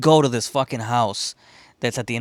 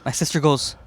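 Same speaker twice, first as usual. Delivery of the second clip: ordinary talk, nothing marked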